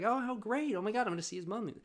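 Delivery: high, gushing mock delight. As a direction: squeal it like great news. Mom's delighted reply, imitated.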